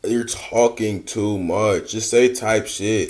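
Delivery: slow and low, coaching her reply; slow, low advice. Acted. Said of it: "host voices advice for her".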